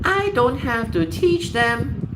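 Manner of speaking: very high, slow caricature of a parent; mocked complacent parent. Parent shrugging off teaching proper English, mocking imitation. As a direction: mimic a parent in drawn-out falsetto. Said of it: "because they're like".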